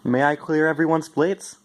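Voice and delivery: high, sugary polite offer; overly polite. Acted. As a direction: play the perfect angel kid. Greg acting extra polite.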